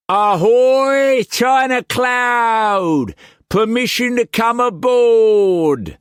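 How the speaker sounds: high, loud, slow drawn-out hail; pirate shout. A narrator's reading. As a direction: hail the ship like a swaggering pirate.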